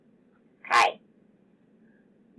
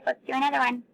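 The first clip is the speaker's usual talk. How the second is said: normal talking voice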